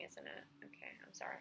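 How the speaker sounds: sudden hushed whisper; real whisper. Real, not acted. Embarrassed student whispers an apology for her screeching voice. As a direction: drop to a breathy whisper to apologize.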